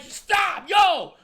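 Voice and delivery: very high, loud exasperated yell; retold shout. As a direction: yell over two people arguing. Retells himself breaking up shouting match.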